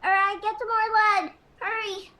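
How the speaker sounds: high-pitched call to hurry; urgent order. Acted. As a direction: send a helper off in a rush.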